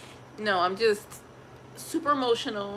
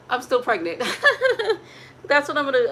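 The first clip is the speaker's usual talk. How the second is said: laughing out loud, louder and higher; 4 semitones higher, 8 dB louder, faster than usual. Real, not acted. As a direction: burst into a big laugh at yourself. Laughing about crying while pregnant.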